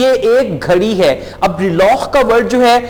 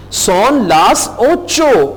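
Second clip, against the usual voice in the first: Son las ocho stretched out slowly; slow, careful Son las ocho; very slow model phrase; much slower than usual. Shown as a demonstration. Teacher slowly models telling the time. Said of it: "teacher models a time phrase".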